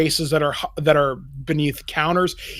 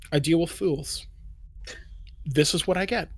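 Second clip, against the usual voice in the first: soft, halting talk to himself; resigned soliloquy; 9 dB quieter than usual. Acted. Elf alone complaining about fools, soft and resigned. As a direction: say it quietly to yourself, with pauses.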